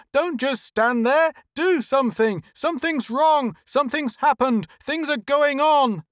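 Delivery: high, loud scream. A narrator's reading. Lois screaming at his staff over traffic, high and loud. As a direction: scream for help in desperation. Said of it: "he screamed".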